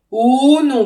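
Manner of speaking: drawn-out, loud, high "oh non"; alarmed doctor. A narrator's reading. Doctor sees the birth is imminent, alarmed. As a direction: cry out the doctor's sudden alarm.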